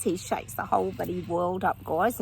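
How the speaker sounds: ordinary talk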